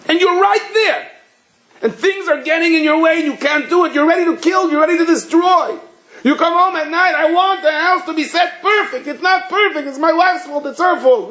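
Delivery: very high-pitched, worked-up tirade; mock a control freak's tantrum at high pitch; acted angry rant. Acting a controlling person's angry rant.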